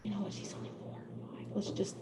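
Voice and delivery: whispered quickly, almost no voice; gentle whisper. Retold or imitated. Teacher whispering to the mother about her son. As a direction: hush it fast and soft, gently reasoning. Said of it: "I'd kind of be like".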